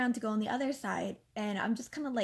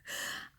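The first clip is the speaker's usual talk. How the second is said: short breathy laugh; incredulous laugh; 7 dB quieter than usual. Real, not acted. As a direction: let out a small disbelieving laugh. Laughs at her own story.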